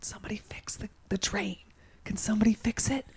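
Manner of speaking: urgent, pleading whisper under the breath; barely audible whispered plea; whispering to oneself. Retold or imitated. Wedding watcher fretting over the bride's train, whispering to herself. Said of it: "just whispering to myself".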